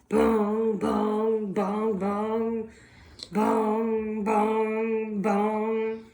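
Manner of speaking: booming bell, fading away; bell bong. Acted. Bell ringing while the bird flees.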